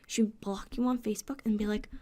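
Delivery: ordinary delivery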